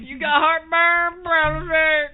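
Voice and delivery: hammed-up infomercial hard sell. Mock southern TV ad.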